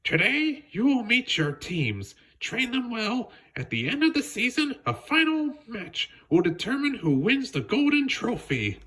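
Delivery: high, formal announcing voice for the club chairwoman. A narrator's reading. Chairwoman welcoming the captains, high and formal. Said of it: "the chairwoman of the club said".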